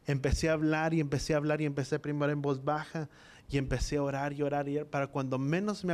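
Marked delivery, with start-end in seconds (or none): none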